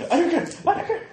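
defiance climbing to a squeal; very high, playful refusal, rising each time; mock defiance. Player brushes off another's objection, mock defiant squeal.

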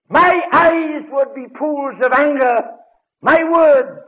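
high, loud and slow, fury rising; building anger. Acted. Preacher picturing his own rage at Peter, loud and high. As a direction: pour it out like lava.

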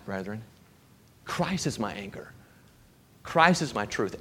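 usual speaking voice

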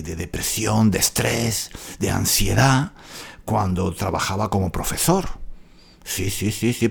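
dropped to a low, hushed voice; hushed aside. Real, not acted. Host lowers his voice about teacher depression, hushed. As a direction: say it quietly, as if it shouldn't be said. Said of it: "ahora no puedo hablar de estas cosas".

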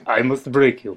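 lightly voiced Russian movie villain; Russian accent. Repeating the break joke for the guest, light Russian accent. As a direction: put on a hint of a Russian accent. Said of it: "say in a Russian voice".

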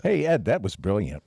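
ordinary delivery

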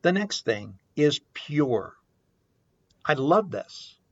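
usual speaking voice

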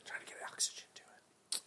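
breathy stage whisper. Acted. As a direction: whisper it as a joke. Unvoiced whisper.

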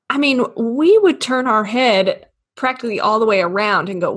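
ordinary talk, nothing marked

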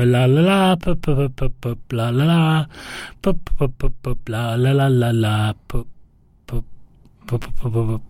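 light "pu" lip pops and la-las; soft lip popping. Shown as a demonstration. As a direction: pop the lips softly, jazzy. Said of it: "You got a jazz mouth".

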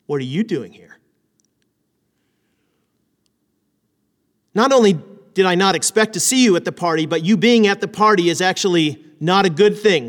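questioning with a sharp edge; edgy question. Shown as a demonstration. Pastor showing the edgy way to ask, disapproving. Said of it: "a way to ask that question that's got a little edge".

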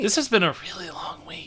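soft whispered aside. Real, not acted.

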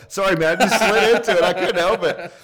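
high, loud excuse through laughter; laughing mock apology. Excusing his accidental accent to the Scottish guy, laughing apology. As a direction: blurt the sheepish apology while cracking up.

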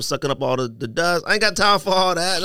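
ordinary delivery

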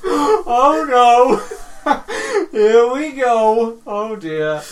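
loud high cry of despair, slowed and laughing; mock horrified. Real, not acted. Host reacting to co-host's accidental Calcutta accent, laughing mock horror.

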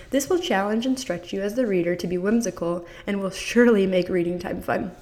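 usual speaking voice

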